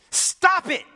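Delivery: loud, high, scolding shout; harsh rebuke. Pretend. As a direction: condemn the sinner in front of the crowd, hammed-up. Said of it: "he could have had some real umph".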